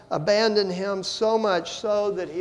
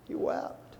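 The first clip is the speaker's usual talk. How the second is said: whispered; 11 dB quieter than usual. Real, not acted. Preacher whispers the refrain.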